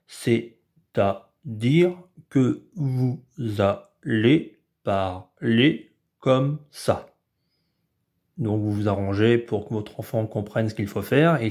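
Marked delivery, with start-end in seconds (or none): 0.1-7.1 slow, syllable by syllable like a robot. Shown as a demonstration. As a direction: speak each syllable like a robot. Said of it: "parler comme un robot". (voix de robot, syllabe par syllabe)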